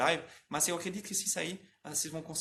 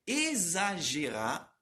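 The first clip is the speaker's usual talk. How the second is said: "exagerar a pronúncia" esticado, sílaba por sílaba; articulação exagerada; slower than usual. Shown as a demonstration. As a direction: articule devagar, abrindo muito a boca. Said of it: "Vocês estão vendo que eu tô exagerando muito?". (bem devagar, articulação exagerada)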